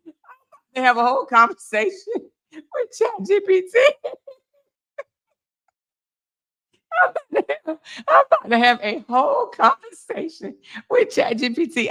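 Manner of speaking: words squeezed out through high laughter; can't stop laughing while saying it. Real, not acted. Live-streamer to chat, cracking up in laughter. Laughing at shocking livestream gossip.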